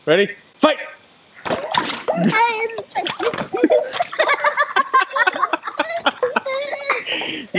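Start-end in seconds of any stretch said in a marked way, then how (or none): 1.4-7.5 long high-pitched laugh; high laughter. Real, not acted. Kids slipping in slime.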